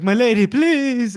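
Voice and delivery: high, loud hammed-up begging; mock pleading. Retold begging on his knees.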